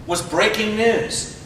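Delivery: drawn-out, slow announcement of breaking news. Shown as a demonstration. Slowed down to tease the audience. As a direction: stretch the words out as a joke. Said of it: "Well, not really breaking, it was slow breaking".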